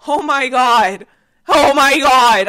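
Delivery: slow, loud oh my God; exasperated loud groan. Real, not acted. Exasperated at guys calling her mom mother-in-law. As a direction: drag it out in exasperation.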